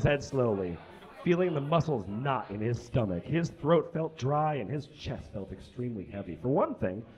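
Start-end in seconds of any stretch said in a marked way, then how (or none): none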